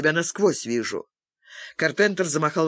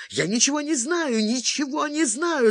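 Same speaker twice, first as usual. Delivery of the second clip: much higher desperate squeal; squealing in panic; 10 semitones higher than usual. A narrator's reading. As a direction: squeal the denial, terrified. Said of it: "заверещал Карпентер".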